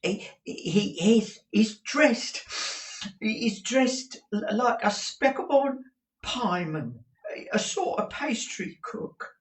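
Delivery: high, stammering eager reply; brightened. A narrator's reading. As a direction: perk up hopefully. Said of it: "Mike brightened and began again".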